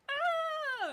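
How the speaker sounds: high startled shriek; shocked shriek. Retold or imitated. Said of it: "they're just like".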